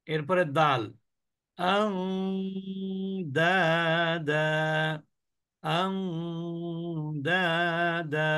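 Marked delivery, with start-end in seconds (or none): 1.6-8.3 আং with a sustained nasal "ng" before each drawn-out দা; ghunnah demo. Shown as a demonstration. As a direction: hum through আং, then chant দা দা slowly. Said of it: "অনিশ্বারের মতন উচ্চারণ করছি".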